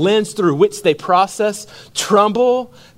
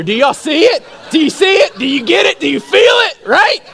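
much higher, louder, building fervour; rousing preacher call; 10 semitones higher, 3 dB louder than usual. Real, not acted. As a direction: fire up the church with each question.

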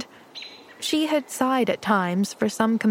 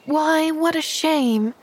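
slow, higher sympathetic sigh; sympathizing; 7 semitones higher, slower than usual. Acted. Pollyanna pities the homeless orphan boy, sympathetic. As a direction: pity him gently, drawn out. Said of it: "sympathized Pollyanna".

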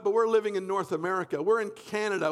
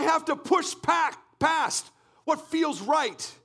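fervent, raised preaching voice with shouted peaks; preaching at full force; 4 semitones higher than usual. Acted. Pastor urges the congregation to cry out, fervent preaching. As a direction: drive it home to the church.

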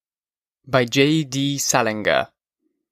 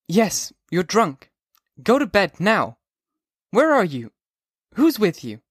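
put-on high, sharp girl's voice; a girl woken by a drunk caller; young woman Sally; 8 semitones higher, slower than usual. A narrator's reading. Sally answers drunk Holden, annoyed.

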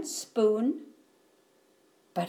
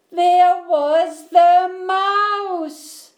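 very drawn-out, loud, high wail; exasperated reveal; 8 semitones higher, 10 dB louder, much slower than usual. A narrator's reading. Narrator finds mouse in the fridge, wailing exasperatedly. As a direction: stretch every word in dismay. Mouse found yet another time.

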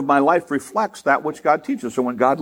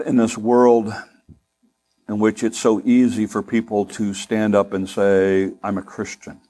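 lower, calm closing prayer; 6 semitones lower than usual. Real, not acted. Pastor closes the sermon praying for his congregation, calm.

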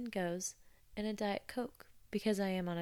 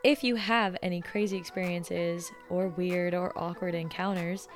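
louder, upbeat sign-off over music; podcast outro read; 6 dB louder than usual. Acted. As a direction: wrap up the show like a host thanking listeners.

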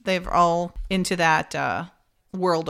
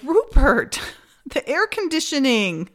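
raised-pitch call to a dozing man, amused; high retold prompt with a laugh inside; mock prompting; 6 semitones higher, 3 dB louder than usual. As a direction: imitate nudging a sleeper back on topic. Acting out rousing a snoring man.

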